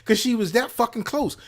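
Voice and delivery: normal talking voice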